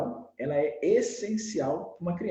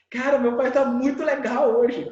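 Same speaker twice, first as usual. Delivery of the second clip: put-on louder, higher delighted kid; excited child's remark; 6 semitones higher, 6 dB louder than usual. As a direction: marvel at dad like a child. Voices an amused child about dad. (criança empolgada, mais alto e agudo)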